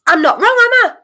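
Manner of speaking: high excited claim. Real, not acted. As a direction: lift it up with excitement.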